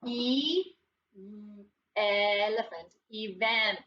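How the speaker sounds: "E, elephant. Event." with a long isolated E, then the whole word; sounding it out. Shown as a demonstration. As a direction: separate the sounds before blending "Event". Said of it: "Sound it out".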